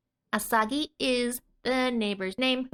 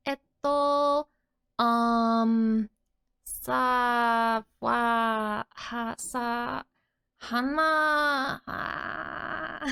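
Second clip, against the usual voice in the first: hesitant groping for a word; much slower than usual. Acted. Girl struggles to recall a word.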